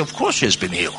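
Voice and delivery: a quiet, flat of-course reply; dismissive retort. Retold or imitated. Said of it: "I say".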